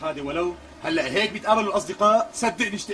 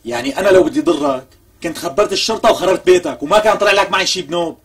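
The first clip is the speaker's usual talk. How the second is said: loud threat; 8 dB louder than usual. Acted. Threatening Shehadeh with police.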